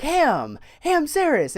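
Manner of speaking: high, loud holler; imitated name-calling. Coworker hailing him by nickname, imitated high call. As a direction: call out the nickname like your buddy. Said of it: "he was just like".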